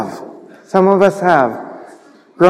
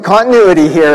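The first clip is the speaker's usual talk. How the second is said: ordinary talk, nothing marked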